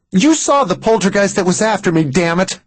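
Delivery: high, heated woman's voice; exasperated Mary. A narrator's reading. Mary protests to doubting Max, exasperated. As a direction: protest in a high, angry pitch.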